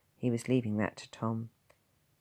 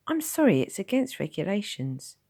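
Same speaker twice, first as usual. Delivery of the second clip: higher, slightly louder nurse voice; young nurse; 8 semitones higher, 5 dB louder than usual. Acted. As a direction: apologise but hold firm.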